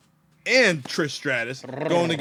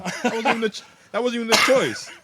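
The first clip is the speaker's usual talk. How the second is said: laughing indignant shout; 10 semitones higher, 8 dB louder, faster than usual. Retold or imitated. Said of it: "I said".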